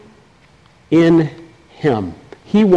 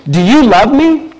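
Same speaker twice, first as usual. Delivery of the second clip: higher, louder, pressing question; a mildly higher pleading Jesus; Jesus asking a third time; 7 semitones higher, 3 dB louder than usual. Acted. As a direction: ask again with rising urgency. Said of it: "Then Jesus said a third time".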